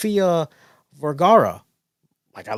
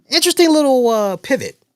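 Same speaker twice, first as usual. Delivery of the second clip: surprised; 11 semitones higher, 8 dB louder, faster than usual. Real, not acted. Surprised remark on a show twist.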